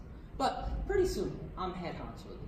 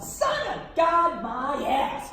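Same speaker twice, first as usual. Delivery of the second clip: loud, raised, stretched-out mockery; scornful jeer; 5 semitones higher, 7 dB louder, much slower than usual. Comic gloating over shot-down Jesus, loud scorn. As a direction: crow it with contempt.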